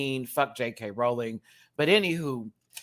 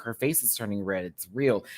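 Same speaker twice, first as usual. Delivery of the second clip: usual speaking voice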